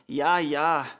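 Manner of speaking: loud, drawn-out outburst; calling out. A narrator's reading. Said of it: "rief die Mutter". (lauter Ausruf)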